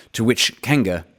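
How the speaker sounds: ordinary delivery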